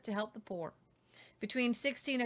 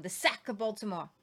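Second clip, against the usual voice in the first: ordinary talk, nothing marked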